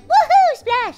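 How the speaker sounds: gleeful cheer. Retold or imitated. Friend quotes the brave little kid jumping in, gleeful cheer. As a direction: imitate a thrilled little kid leaping. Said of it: "he's already like".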